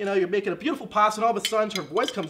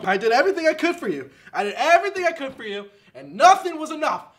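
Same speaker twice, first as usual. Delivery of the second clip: higher, louder pleading lament; a comic man coming apart; anguished outburst; 6 semitones higher, 4 dB louder than usual. Acted. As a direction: pour out the hurt, building. Breakdown, rising anguish.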